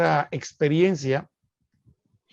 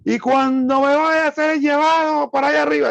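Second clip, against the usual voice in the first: high, louder Puerto Rican preacher imitation; Puerto Rican preacher accent; 9 semitones higher, 3 dB louder than usual. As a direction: mimic a Puerto Rican evangelist preaching the rapture. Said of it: "con ese mismo acento que yo escuchaba". (imitando acento de predicador puertorriqueño)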